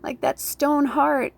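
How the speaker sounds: indignant mock protest, drawn out and loud at the end; mock accusing. Imagined viewer accusing her of being cold-hearted, mock accusing. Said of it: "may sound really fucking harsh and cruel".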